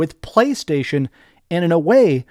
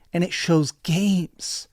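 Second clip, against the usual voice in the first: ordinary talk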